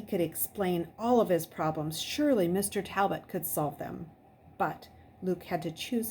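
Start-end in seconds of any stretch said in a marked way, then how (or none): none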